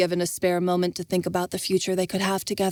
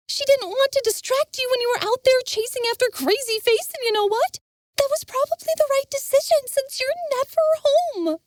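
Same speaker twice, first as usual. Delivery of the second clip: put-on much higher, heated young woman; Jenna. Jenna scolding Envis, heated. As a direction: scold him sharply.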